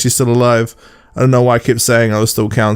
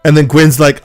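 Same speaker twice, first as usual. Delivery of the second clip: normal talking voice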